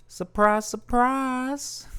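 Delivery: very high, silly "surprise" voice; playful put-on voice. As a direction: pipe it up high for a laugh. Puts on catchphrase, then laughs at it.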